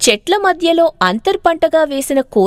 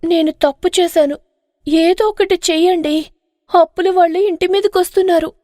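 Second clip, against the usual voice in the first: higher, hurried pleading woman's voice; panicked wife; 5 semitones higher than usual. Acted. Kantamma admits her mistake and begs her husband for help, panicked. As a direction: beg him to do something, fast.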